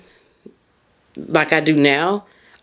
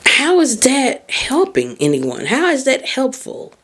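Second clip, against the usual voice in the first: drawn-out, disbelieving rhetorical question, pitched up; incredulous question; 9 semitones higher, slower than usual. Real, not acted. Coach asking listeners why blame helps, incredulous. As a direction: really question it, like it makes no sense.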